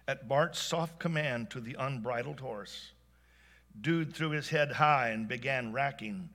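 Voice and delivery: ordinary delivery, nothing marked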